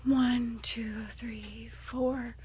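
whispered counting under the breath; whispering. Real, not acted.

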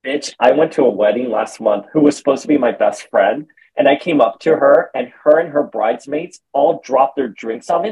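usual speaking voice

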